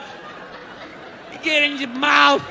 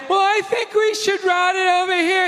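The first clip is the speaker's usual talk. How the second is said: mock meddling wife; 8 semitones higher than usual. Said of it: "his wife ... comes walking in".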